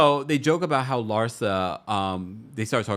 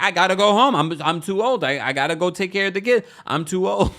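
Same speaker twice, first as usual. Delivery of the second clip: mock whiny excuse; 9 semitones higher, 6 dB louder than usual. Adriana voiced leaving.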